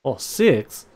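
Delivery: ordinary talk, nothing marked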